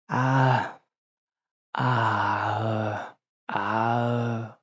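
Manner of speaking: long, drawn-out groggy "ah"; mock dazed, slow moans; imitated possum moan. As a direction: groan like a half-asleep animal.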